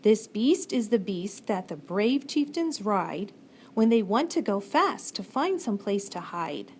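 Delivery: ordinary delivery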